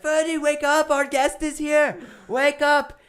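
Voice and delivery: high, loud call to wake someone; yelling. Retold or imitated. Imitating the worker waking Ferdy for the guest, loud yell. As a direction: shout him awake from outside. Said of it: "Just starts yelling".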